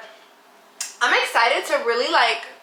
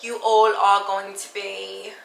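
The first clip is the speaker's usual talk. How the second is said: British accent. Shown as a demonstration. Nervous vlogger auditioning her accent for viewers, British accent. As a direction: keep up an amateur British accent, searching for words. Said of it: "I can speak in a British accent".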